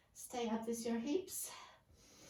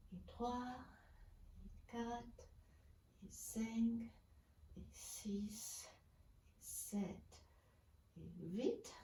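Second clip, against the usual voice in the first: breathy whisper to close the set; whispered count; 7 dB quieter, much slower than usual. Real, not acted. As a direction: count softly under your breath, almost whispering. Counting reps, voice drops to whisper.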